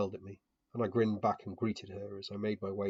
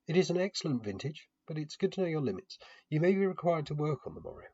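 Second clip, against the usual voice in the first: lightly voiced hostess, a bit higher; a hint of a kindly hostess advising restraint; 7 semitones higher, 3 dB louder than usual. Hostess advising her guest about the wine, lightly voiced.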